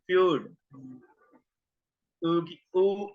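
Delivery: "फ्यूड" then a long "ऊ"; eu sound. Shown as a demonstration. Teacher repeating the eu sound for students. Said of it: "इसी तरह इसकी sound है यू".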